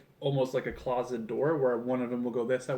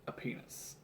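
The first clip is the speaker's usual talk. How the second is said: whispered; 17 dB quieter than usual. Real, not acted. Joking about penis-shaped Lego piece.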